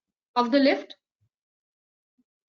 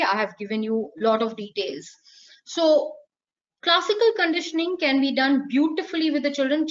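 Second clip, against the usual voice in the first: ordinary talk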